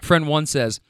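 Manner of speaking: ordinary delivery, nothing marked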